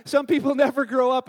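laughing through the words. Real, not acted. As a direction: chuckle as you say it, amused and loud. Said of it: "and it's funny".

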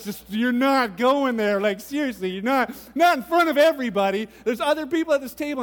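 exasperated protest. Retold or imitated. Retelling embarrassment at wedding table.

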